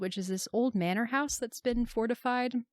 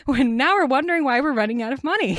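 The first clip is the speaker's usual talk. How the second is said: louder, higher, fast joking voice; mock-baffled filmmaker; 6 semitones higher, 10 dB louder, faster than usual. Co-host plays filmmakers puzzled at their budget, mock-baffled. Playing clueless filmmakers, laughing along.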